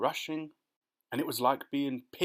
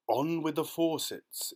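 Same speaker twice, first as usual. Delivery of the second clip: slow, louder far-off call; booming shout; 4 semitones higher, 3 dB louder than usual. Acted. Cloud men shout from above, slow and booming. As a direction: boom down from the cloud. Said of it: "it shouted".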